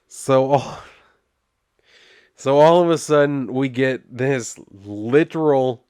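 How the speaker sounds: ordinary talk, nothing marked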